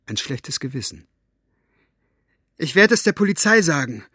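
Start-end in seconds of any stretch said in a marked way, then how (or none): 2.6-4.0 loud, high, blurted threat. Acted. Girl blurting a threat at her aunt. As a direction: force the words out in agitation. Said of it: "stieß Maggie hervor".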